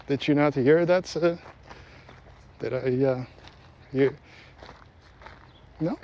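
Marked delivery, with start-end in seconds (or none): none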